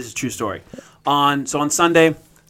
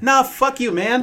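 mock brush-off; 10 semitones higher than usual. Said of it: "They're like".

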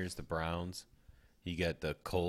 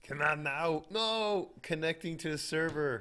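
exasperated; 9 semitones higher than usual. Real, not acted. App glitching during the draft.

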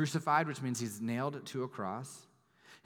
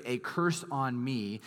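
"A curse on me" said slowly, each word weighted; weighty slow phrase; slower than usual. Shown as a demonstration. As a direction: dwell on "A curse on me". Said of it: "that phrase".

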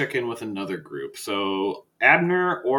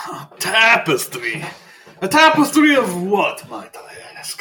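pitched-up, louder mock disbelief at the tapestry; incredulous boss; 9 semitones higher, 6 dB louder, slower than usual. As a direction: bristle at the idea, mostly high and loud, then drop away to near-breath on the last words. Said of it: "And then goes".

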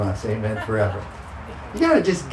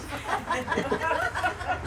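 high laugh; 11 dB quieter than usual. Real, not acted. Laughs at the story.

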